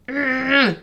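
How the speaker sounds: loud, high exasperated groan; mock frustrated groan; frustrated ugh. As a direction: let out a fed-up ugh. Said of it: "I'm just like".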